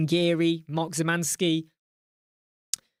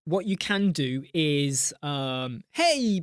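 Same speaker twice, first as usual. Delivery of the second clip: ordinary talk, nothing marked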